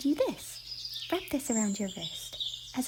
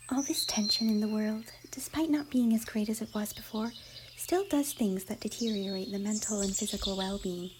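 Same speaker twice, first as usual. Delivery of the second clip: ordinary delivery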